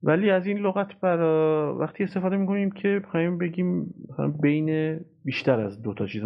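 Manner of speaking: ordinary talk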